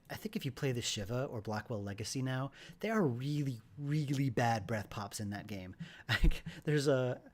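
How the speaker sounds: ordinary talk, nothing marked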